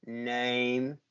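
low, soft, one-word mumble of indifference; disinterested clerk. Retold or imitated. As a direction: imitate a clerk who can't be bothered to look up. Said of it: "he barely looks up".

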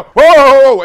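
loud, high startled whoa; hammed-up fright at the fence; mock panic. Kid failing a jump, mock panicked yelps. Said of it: "they go".

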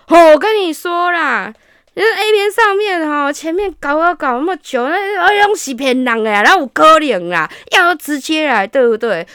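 mock pushy guy. The date persuading him, smug pushy voice. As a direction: play the smug guy selling it. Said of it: "然后他就说".

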